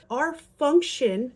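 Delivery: Our function, said slowly and loudly; slow emphasis. Shown as a demonstration. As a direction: lean hard on Our function. Stressing the parent's key role.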